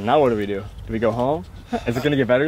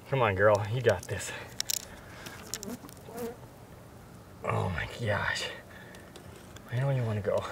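soft coaxing; 3 semitones lower, 12 dB quieter than usual. Real, not acted. Coaxing released fish to swim.

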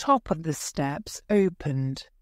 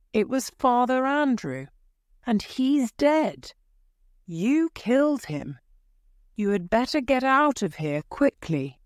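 raised pitch, quickening at the end; mildly acted alarm, hurrying to the finish; urgent warning; 7 semitones higher than usual. A narrator's reading. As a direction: warn a friend urgently, pitched up. Friend warns of a killing.

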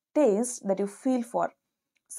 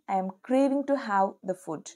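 ordinary talk

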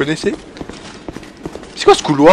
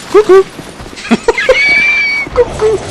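taunting coucou. Acted. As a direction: tease the enemy like peekaboo. Taunting an enemy with greetings.

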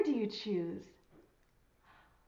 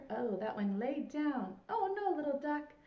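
normal talking voice

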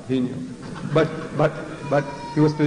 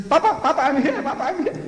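put-on much higher, laughing little child calling Papa; giggling child; 11 semitones higher than usual. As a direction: play the giggling three-year-old answering grandpa. Voicing the giggling grandchild.

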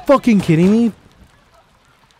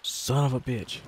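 much softer, lower, half to himself; muttered under the breath; 11 semitones lower, 14 dB quieter than usual. Real, not acted. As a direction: mutter it quietly in disbelief. Streamer mutters the line again.

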